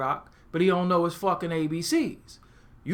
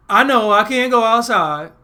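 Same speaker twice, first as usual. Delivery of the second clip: loud, higher mock-kid protest; defiant child; 5 semitones higher, 12 dB louder than usual. Punished kid chalking it up, loud and defiant. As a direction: belt it out like a kid who's past caring.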